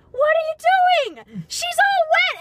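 high, loud, slow mock-surprised Harry; loud high retold Harry asking what she's doing; imitating Harry. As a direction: play Harry startled at the splash. Harry's reaction voiced in story.

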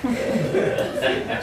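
ordinary talk

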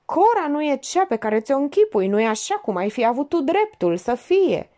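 high, pleading near-tearful voice; tremulous, near tears. A narrator's reading. Woman breaking bad news about Cora, tremulous near tears. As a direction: plead as if about to burst into crying. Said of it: "Glasul ei tremurat aștepta".

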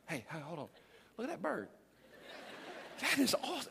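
hushed, drifting off to a bird; suddenly distracted, voice softened; soft awed aside about a bird; distracted listener. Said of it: "and I'm like".

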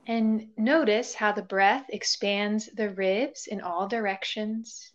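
calm body-scan voice with long pauses; guided meditation. Real, not acted. Yoga teacher leading listeners through a body scan, guided meditation. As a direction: guide them gently into stillness, leaving space between phrases.

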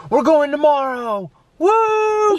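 high, drawn-out celebratory cry; acted-out squeal of excitement, ending in a woo; excited announcement. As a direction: break the big surprise, whooping. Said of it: "we're gonna be like".

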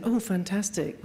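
ordinary talk, nothing marked

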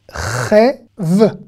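ordinary talk

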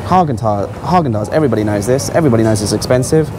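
usual speaking voice

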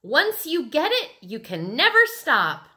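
put-on high, loud, giddy kid voice; excited shrieking. A narrator's reading. Mitzi explains the craze to Sheila, excited shrieking. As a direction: call it out while whirling.

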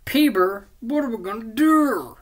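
high, loud silly voice; goofy mock panic. Harry asking Peter what to do, goofy high mock panic. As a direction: wail it like a cartoon worrier. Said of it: "and he's like".

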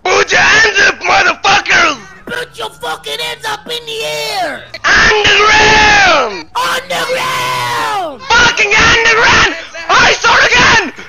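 shouting. Acted. Police arrest, screaming commands.

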